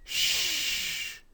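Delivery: "Sh" as a long soft hiss; "Sh" held alone, no vowel; sound demo. Shown as a demonstration. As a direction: draw out "Sh" with no voice. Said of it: "How is it pronounced?".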